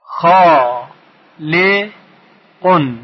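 khaa held long, then short li, then qun; syllable by syllable. Shown as a demonstration. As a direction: break "خالق" into khaaa - li - qun with pauses. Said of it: "اگر بخوایم بخش کنیم".

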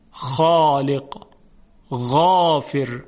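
خالق and غافر slow, with full, thick aa; heavy emphatic vowels. Shown as a demonstration. Teacher pointing out heavy kha and ghain to students. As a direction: stretch out the heavy aa sounds. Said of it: "دقت کنید".

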